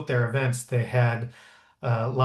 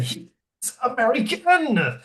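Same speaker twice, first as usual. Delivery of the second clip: put-on higher, slow, French-accented delight; drawn-out French-accented announcement, pitched well up; Sarkozy's French-accented voice; 12 semitones higher, 4 dB louder, much slower than usual. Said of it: "slapped President Bush on the back and said".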